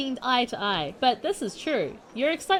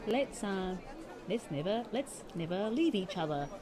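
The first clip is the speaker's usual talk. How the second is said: soft, lower, clingy plea; mock clingy plea; 3 semitones lower, 7 dB quieter than usual. Shown as a demonstration. Mocking what Hana should say to John, soft clingy plea. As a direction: mock-desperate lingering goodbye. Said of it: "That's what you say".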